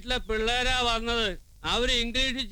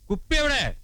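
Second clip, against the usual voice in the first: louder demand. Acted.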